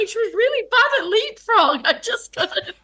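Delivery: high, laughing imitation of a crying child; mock crying child. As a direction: play yourself as a tearful seven-year-old, half laughing. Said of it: "I'm, like, crying".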